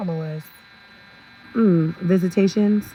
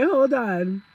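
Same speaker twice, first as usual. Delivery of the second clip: ordinary talk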